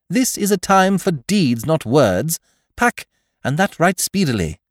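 rally the valet to action; urgent young master. A narrator's reading. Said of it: "Bertie giving orders".

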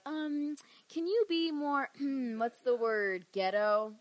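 high, loud mock-hesitant asking; awkward request. TNA asking her to use her accent, mock-awkward.

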